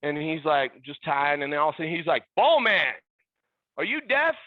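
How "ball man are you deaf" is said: loud, high, indignant call; imitated yell. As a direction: holler at someone ignoring you. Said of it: "I didn't like the way he yelled it".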